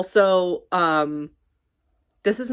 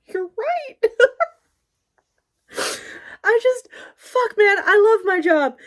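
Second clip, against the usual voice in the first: mock tearful, very high-pitched squeak; pretend crying; 5 dB louder, slower than usual. New employee overcome by coworker's kindness, pretend crying. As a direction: fake a weepy breakdown, half laughing. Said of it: "I pretended to cry just now".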